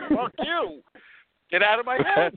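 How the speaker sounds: high-pitched mock outburst; defiant Michael. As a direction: snap back at a nagging voice, play-acting. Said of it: "And he's like".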